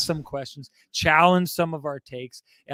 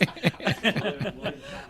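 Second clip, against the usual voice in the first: long amused laugh; high laugh; 4 semitones higher, 5 dB quieter than usual. Real, not acted. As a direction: burst out laughing. Laughing at the co-host's excuse.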